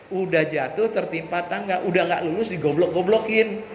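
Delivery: usual speaking voice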